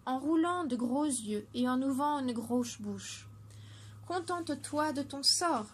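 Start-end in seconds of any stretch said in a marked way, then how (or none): 4.1-5.7 mild call from a little water drop; lightly voiced cry, a touch teasing; a hint of a shout up to the fish. A narrator's reading. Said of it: "lui cria Perlette".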